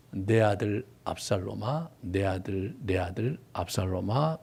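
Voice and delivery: soft, low, slow lament for a lost son; mournful lament. Retold or imitated. David weeping over his son Absalom, soft mournful cry. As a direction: grieve quietly over the son's name. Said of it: "그러면서 엉엉 웁니다".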